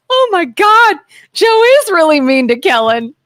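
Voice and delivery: high, mock-shocked gasp of a commenter; mock outraged viewer. Mocking how a viewer would gasp at the clip. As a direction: whine it like an offended viewer. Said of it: "make it look like".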